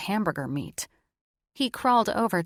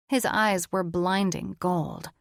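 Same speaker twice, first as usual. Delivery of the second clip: plain reading voice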